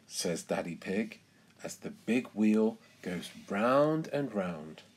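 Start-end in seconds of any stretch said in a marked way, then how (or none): none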